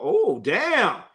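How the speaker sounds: ordinary delivery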